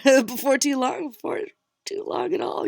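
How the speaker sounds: high, loud, laughing through the words; giggling at her own stumble. Real, not acted. Reader laughing at her own slip-up, giggling.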